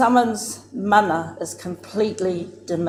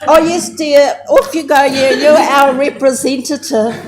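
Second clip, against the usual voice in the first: breezy dismissal; 6 semitones higher, 9 dB louder than usual. Retold or imitated. Aunties dismiss the negotiator.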